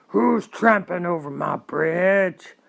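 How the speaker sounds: loud, slow troll roar. A narrator's reading. Troll challenges the big goat on the bridge, roaring slowly. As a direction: bellow slowly as the angry troll. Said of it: "roared the troll".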